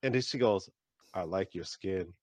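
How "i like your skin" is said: low, soft, slow admiring line; imitated coy compliment. Young cashier admiring a customer's skin, imitated soft compliment. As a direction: drop it slow and quiet.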